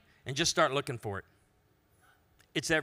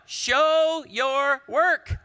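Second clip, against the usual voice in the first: loud, high, drawn-out command; math teacher's demand; 10 semitones higher, 8 dB louder, much slower than usual. Acted. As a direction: hammer out each word slowly. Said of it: "the math teacher said".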